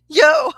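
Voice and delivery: high, loud laughing yelp; loud burst of laughter. Real, not acted. Laughing hard at her own spooky story, loud and high. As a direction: let the laugh explode out.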